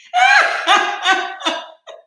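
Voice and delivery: shrieking laugh, high and loud; high burst of laughter. Real, not acted.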